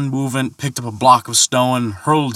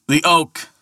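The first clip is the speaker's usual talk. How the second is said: shouting across water; 8 semitones higher than usual. A narrator's reading. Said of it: "he shouted across the shining water".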